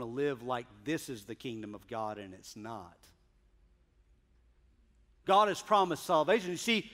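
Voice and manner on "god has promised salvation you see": louder, higher pulpit delivery; preaching. Acted. Preacher pressing the text home to the congregation, rising preaching. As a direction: build the point to the congregation.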